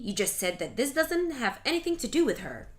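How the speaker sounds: low sneering mother-in-law voice. Acted. Scolding mother-in-law sneers at her daughter-in-law. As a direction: drop the voice low and accuse her.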